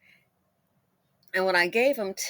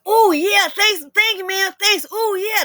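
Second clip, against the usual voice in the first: put-on excited kids; 11 semitones higher, 11 dB louder, slower than usual. Kids thanking for cash.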